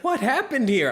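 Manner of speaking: high, loud, startled question; alarmed manager. Retold or imitated. Bartender imitates manager coming out, alarmed manager. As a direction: act out the manager's shocked arrival. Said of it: "he looks, and he's like".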